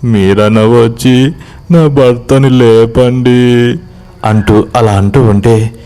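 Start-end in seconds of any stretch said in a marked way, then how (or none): 0.0-3.4 acted slow wail, pitched up; tearful pleading. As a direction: beg for help in a drawn-out weeping voice. Weeping wife begs the men.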